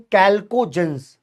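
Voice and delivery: usual speaking voice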